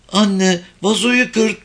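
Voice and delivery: put-on high, slow little girl; small girl's high-pitched line; child character. A narrator's reading. Little girl addresses her mother.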